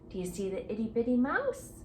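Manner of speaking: ordinary delivery, nothing marked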